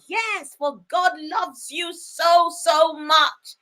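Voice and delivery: usual speaking voice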